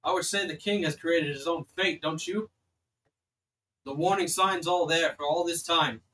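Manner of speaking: loud, indignant guard condemning the king; forceful in-character rebuke. Acted. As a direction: argue back loudly as the sentry. Guard argues with visitors about king.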